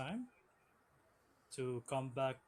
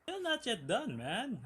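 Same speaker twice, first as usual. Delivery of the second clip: ordinary talk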